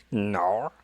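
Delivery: imitated Australian "No", drawn out; mock Australian "No"; Australian accent. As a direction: say "No" with an Aussie vowel. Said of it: "the way she said".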